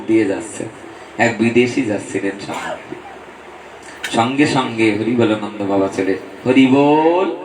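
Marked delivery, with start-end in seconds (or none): 6.5-7.4 calling out. Retold or imitated. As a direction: call "Hari bol" out across the crossing. Sadhu calling out to foreigner.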